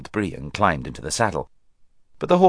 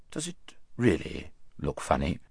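anxious; 5 semitones lower, 10 dB quieter than usual. A narrator's reading. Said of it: "he asked in an anxious voice".

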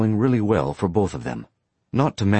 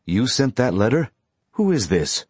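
stern; 5 semitones higher than usual. A narrator's reading. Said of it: "with a very stern voice".

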